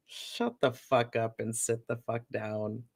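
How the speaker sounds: ordinary delivery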